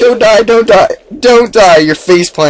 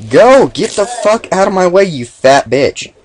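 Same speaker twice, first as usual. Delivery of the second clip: angry shout; 8 semitones higher than usual. Real, not acted. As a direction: shout at the game. Yelling at game character.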